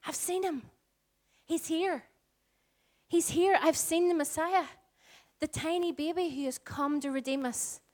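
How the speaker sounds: put-on high, thrilled old woman announcing; elderly Anna telling everyone, excited and high. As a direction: burst out with joyful news. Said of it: "and saying".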